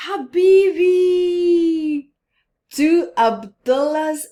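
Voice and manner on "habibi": loud, high, drawn-out cry of delight; elated slow shout; joyful cry. Acted. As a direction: shout it out in amazement.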